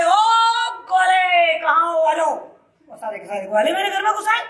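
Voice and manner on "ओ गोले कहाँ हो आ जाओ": put-on loud call to the cowherd boys; Krishna loudly calling his friends in. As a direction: shout it across the lanes to the boys. और फिर आवाज लगाई.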